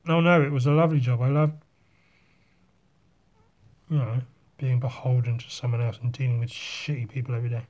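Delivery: dry mock praise for a hated job; deadpan irony about office life; sarcastic. Real, not acted. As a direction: pretend to love it, lightly. Sarcasm about his old job.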